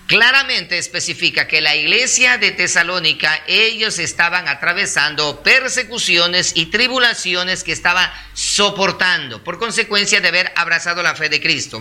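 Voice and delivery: ordinary talk, nothing marked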